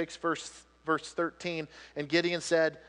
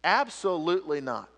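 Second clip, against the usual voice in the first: usual speaking voice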